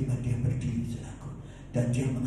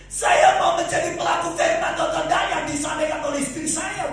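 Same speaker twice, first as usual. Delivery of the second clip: impassioned preaching; 10 semitones higher, 5 dB louder than usual. Real, not acted. Preacher confessing his own test to the congregation, impassioned preaching. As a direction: preach it with rising force, then bring it down.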